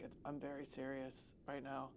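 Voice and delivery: low, very soft mock-solemn voice; mock very serious. Host voicing the guitarist in a photo, mock serious. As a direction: imitate someone being very serious, under your breath. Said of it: "He's like".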